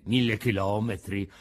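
low-pitched, taken-aback echo; dismayed echo. A narrator's reading. Pinocchio hearing how far the beach is, dismayed echo. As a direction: repeat the distance in dismay.

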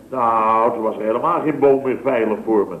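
usual speaking voice

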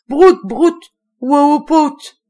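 cri aigu et fort, très haut; cri déchirant de singe. Acted. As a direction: hurle comme un singe affolé. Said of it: "d'une voix déchirante". (cri de singe déchirant et aigu)

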